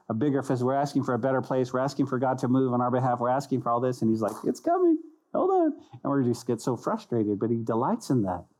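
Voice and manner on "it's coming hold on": very high, comic falsetto; God comically reassuring. Acted. God answers people who keep asking, comic falsetto. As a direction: squeak it out as a playful put-off.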